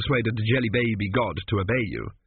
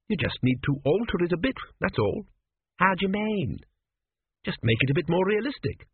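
higher-pitched, reasoning character voice; Tepic, lightly voiced persuader; 7 semitones higher than usual. A narrator's reading. Tepic advises the Sphinx on its riddle, lightly persuasive.

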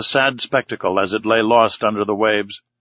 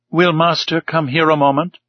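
raised, questioning and intrigued; curious; 4 semitones higher than usual. Acted. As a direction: ask it with puzzled interest. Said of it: "in a curious voice".

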